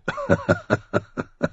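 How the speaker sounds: short rough laugh; gruff laugh. A narrator's reading. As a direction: laugh like a hard soldier. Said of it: "Der Bluthund lachte".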